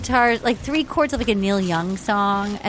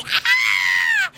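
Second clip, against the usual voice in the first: very high, shrieky mock swoon; hammed-up lovestruck squeal. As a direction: squeal it in overblown infatuation. Hammed-up imitation of her reaction.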